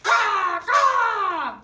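loud, harsh caw; jayhawk caw. Acted. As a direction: squawk it out like a jayhawk. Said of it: "No, no, no".

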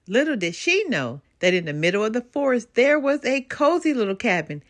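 ordinary delivery

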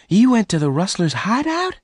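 high, alarmed question; horrified. Acted. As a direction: Bess is horrified he went. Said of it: "Bess asked in horror".

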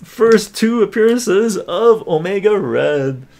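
slow, loud announcement; announcer. Acted. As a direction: announce it grandly. Announcing the won item.

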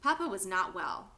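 ordinary delivery, nothing marked

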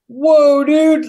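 backing off in a fright; mock panicked "whoa, dude"; startled whoa. Startled as thief approaches.

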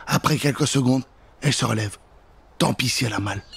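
usual speaking voice

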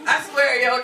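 ordinary talk

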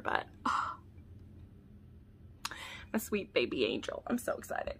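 soft, hushed cooing to a mug; doting whisper. Real, not acted. Fan cooing over her new mug, doting whisper.

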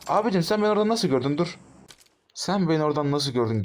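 high, fast incredulous cry; can't believe he saw you, cry it out; shocked disbelief. Real, not acted. Shocked at being spotted. (şaşkınlıkla yüksek sesle)

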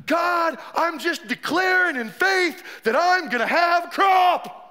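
mock-fervent faith declaration, high and drawn out; declaring believer. Preacher mimicking believers to the congregation, mock-fervent declaring.

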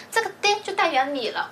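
ordinary talk, nothing marked

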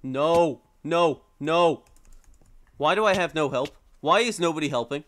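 loud, real exasperation; loud cry for absent help; exasperated shouting. Real, not acted. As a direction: shout in protest at being left alone. Outnumbered in game, nobody helping.

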